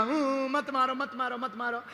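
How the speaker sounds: soft whimpering mock plea; mock crying. Stone begging the sculptor not to hit, mock-crying. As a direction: whine it like a pretend crybaby. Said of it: "रोने लगा".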